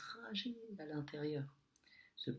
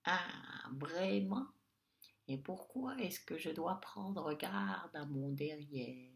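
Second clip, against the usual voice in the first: high, pointed, sour little voice; slow, high, mean witch's question; sharp witch voice; 4 semitones higher, slower than usual. Acted. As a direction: sneer it like a nasty little witch. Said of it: "une petite voix pointue, aigre et méchante".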